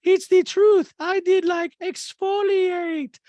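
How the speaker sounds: mocking Diana; high squeaky disgust. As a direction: whine like a disgusted diva.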